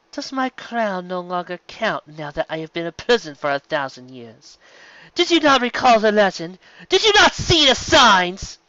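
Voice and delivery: loom over the crowd with scorn; villain queen voice. A narrator's reading.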